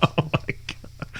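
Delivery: whispered oh my god through laughter; laughing under breath; breathless laugh. Real, not acted. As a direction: gasp it out between laughs.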